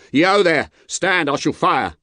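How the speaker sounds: high, loud guard's warning shout; roared challenge. A narrator's reading. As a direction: shout a threat to halt. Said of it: "as loud as he could roar".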